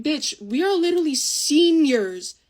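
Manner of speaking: loud, slow, drawn-out retort; indignant telling-off. Retold or imitated. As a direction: stretch it out loudly in disbelief at the kid. Retelling her rebuke of a younger girl.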